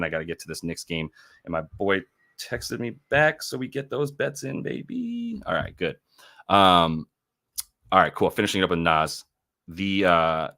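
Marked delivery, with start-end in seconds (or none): none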